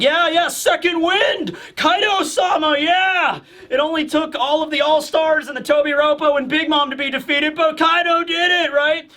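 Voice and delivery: high, giddy mock cheering; rally like henchmen getting a second wind; over-eager, high-pitched hype; cheering underlings. Followers cheer their morale boost.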